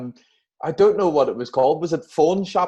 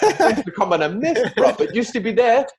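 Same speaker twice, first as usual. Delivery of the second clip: put-on laughing rude boy accent, pitched much higher; London rude boy lament, through a laugh; mock rude boy accent, high and giggly; 10 semitones higher, 3 dB louder than usual. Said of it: "that rude boy accent".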